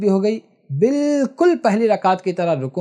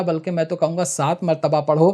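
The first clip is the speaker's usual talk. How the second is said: normal talking voice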